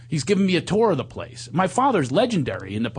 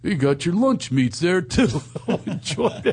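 slow, higher put-on dad voice; cult-leader father; 5 semitones higher than usual. Father helping himself to his son's cheese tray, slow higher put-on voice. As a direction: draw it out as the freeloading father.

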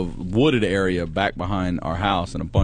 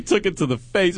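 normal talking voice

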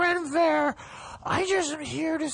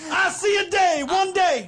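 mock orator; 5 semitones higher, 4 dB louder than usual. Parodying a famous speech.

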